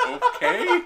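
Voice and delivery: squeaky high okay with a rise; baffled at being handed an empty can; puzzled okay. Retold or imitated. Handed an empty can by the singer, squeaky puzzled okay. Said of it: "I was like".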